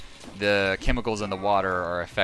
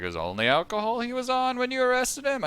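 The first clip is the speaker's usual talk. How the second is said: sarcastic disbelief. Retold or imitated. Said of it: "I was like".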